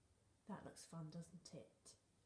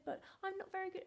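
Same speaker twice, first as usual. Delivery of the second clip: fast, high, mock whiny complaint; whiny quote; 12 semitones higher, 3 dB louder, faster than usual. Teacher quoting her own upset complaint, fast and high. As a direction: whine it like a sulky child. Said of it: "And then I said".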